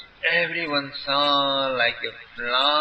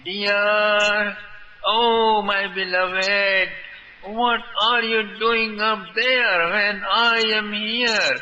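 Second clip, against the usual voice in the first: Radha's call; 7 semitones higher, slower than usual. A narrator's reading. The ball as Radha calling her beloved, sweet and sung. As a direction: lure him down tenderly, half singing. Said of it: "as if saying".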